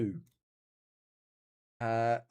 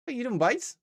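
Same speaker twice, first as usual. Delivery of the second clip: high-pitched mock Newfoundland accent; 10 semitones higher than usual. Gamer imitating Canadian dialect to viewers, Newfoundland accent. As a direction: put on a Newfoundland lilt, pitched up. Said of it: "it might be specifically Newfoundland Canadian".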